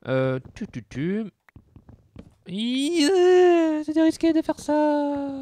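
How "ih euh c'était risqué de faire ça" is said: high, slow squeal of relief after a near fall; startled relief. Real, not acted. Player squealing after barely surviving a risky jump, high and shaky. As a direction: squeak it out, heart still racing.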